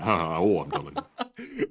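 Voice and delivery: comic's exasperated catchphrase delivery, punchy then dropping low; put-upon stand-up comic impression; Rodney Dangerfield. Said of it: "doing a Rodney Dangerfield impression".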